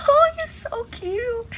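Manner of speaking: high, slow, crying squeal; teary squeal. Real, not acted. Reader swooning over Warner, high teary squeal. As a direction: melt into a squeal over him.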